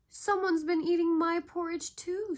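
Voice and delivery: high, loud sulky cub voice; sulking Baby Bear. A narrator's reading. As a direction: whine it like an upset little cub. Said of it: "sulked Baby Bear".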